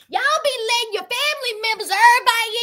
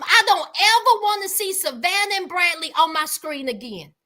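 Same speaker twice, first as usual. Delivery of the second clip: ordinary delivery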